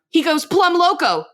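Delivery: hammed-up quoted punchline. Peggy explains Bill's reaction to Hank, hammed-up. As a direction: land the phrase high and emphatic. Quoting Peggy's silly line.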